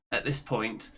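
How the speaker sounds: ordinary talk, nothing marked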